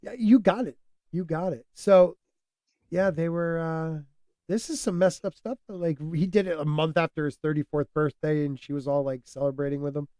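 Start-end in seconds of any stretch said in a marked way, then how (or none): none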